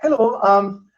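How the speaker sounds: high, melodic Northern Irish lilt; Northern Irish accent. Shown as a demonstration. Showing listeners the Northern Irish accent, melodic and high. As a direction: lift it into a sing-song Northern Irish accent. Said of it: "is very melodic".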